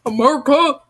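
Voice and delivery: mock-patriotic American exclamation; hammed-up American accent. Reader giving up on French, hammed-up American accent. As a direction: belt it out in a joking American accent. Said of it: "I'm just gonna speak American".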